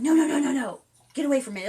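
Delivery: hammed-up frightened parent pulling a kid back; mock panicked parent. A parent yanking a child from a dog, mock panic.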